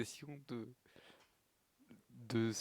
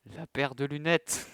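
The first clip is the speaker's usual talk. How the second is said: surprised; 4 semitones higher, 11 dB louder, slower than usual. Real, not acted. Reacting to her glasses.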